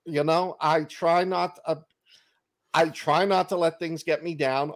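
ordinary talk, nothing marked

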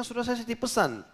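high-pitched, exasperated reproach; retold wifely scolding. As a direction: scold like a wife who warned him already. Wife scolds Jabir over the crowd. (menirukan istri mengomel dengan nada tinggi)

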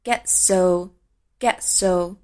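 "get so" said very slowly with the t left out; very slow "get so", t dropped. Shown as a demonstration. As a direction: glide from "get" to "so" with no t. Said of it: "Get的t可以省略".

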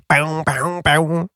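high, soft vocal snare drum sound; playful vocal drum imitation; imitated snare hit. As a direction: mouth the snare like a drummer. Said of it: "Toi on törkeä hieno virvelisoundi".

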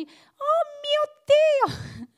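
high-pitched, loud, drawn-out cry of panic; panicked cry. Retold or imitated. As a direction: cry out to God as the boat sinks. Panicking disciples in the storm. (grido di panico acuto e forte, allungato)

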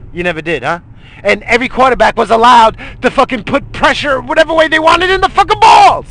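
furious, loud, high yelling; yelling rant. Real, not acted. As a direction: rage at the fans at full volume. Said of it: "Why am I yelling like a fucking moron".

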